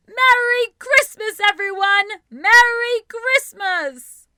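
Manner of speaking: high, loud joyful holiday shout; screaming out loud. A narrator's reading. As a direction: call it out to the whole street, stretching the last greeting. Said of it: "screams out loud".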